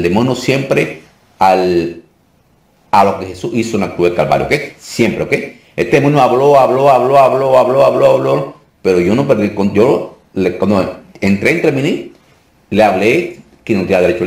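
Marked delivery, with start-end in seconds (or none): 5.8-8.5 "habló" repeated in an unbroken, higher-pitched chain; droning repetition. Retold or imitated. Pastor recounting a demon's chatter, insistently repeated "habló". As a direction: hammer each "habló" evenly to mimic endless chatter.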